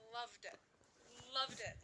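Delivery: "loved" stressed, falling at the end; emphatic LOVED. Real, not acted. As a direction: punch "loved" both times, pausing between. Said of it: "Like, capital L O V E".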